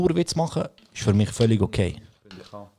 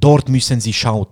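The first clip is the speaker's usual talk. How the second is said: mock a clerk talking down to a foreigner; patronising shop helper; 2 semitones higher, 7 dB louder than usual. Migros worker addressing a supposed non-German speaker, loud and condescending. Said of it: "wieso redest du jetzt so".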